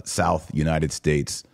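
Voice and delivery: ordinary talk, nothing marked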